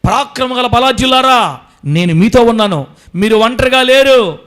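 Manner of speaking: loud, slow, rising divine promise; God reassuring his children, loud and slow. Acted. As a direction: speak as God comforting his children, building. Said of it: "అని చెప్తున్నాడు దేవుడు మనతో".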